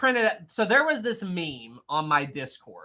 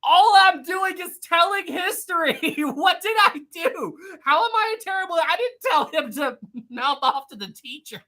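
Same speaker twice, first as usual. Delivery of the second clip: high, laughing protest; mock indignation; 11 semitones higher than usual. Streamer defending herself to chat, high and laughing. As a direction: plead innocence through laughter.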